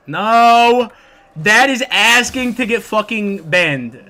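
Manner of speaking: loud, slow no; loud protest. Real, not acted. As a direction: yell it in protest. Loud reaction to chat request.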